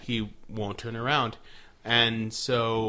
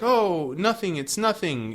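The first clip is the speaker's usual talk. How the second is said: innocent denial; 7 semitones higher, 4 dB louder than usual. Retold or imitated. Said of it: "she's just like".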